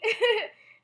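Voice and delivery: a short high nervous laugh. Real, not acted. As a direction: laugh it off for a second, loud and high. Nervous laugh about hard news.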